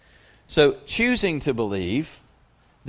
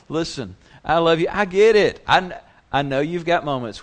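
loving God; 5 semitones higher, 7 dB louder than usual. Retold or imitated. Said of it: "And God is going".

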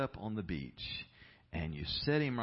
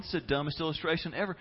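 quick, higher mock complaint; imitated scornful listener; 6 semitones higher, 4 dB louder, faster than usual. Preacher voicing his congregation's reaction, imitated scorn. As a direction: voice a fed-up listener, fast and raised. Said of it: "And you're going".

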